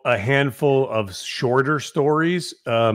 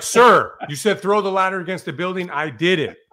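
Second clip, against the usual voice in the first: eager defense; 7 semitones higher, 4 dB louder than usual. Retold or imitated. Rookie defending himself to captain.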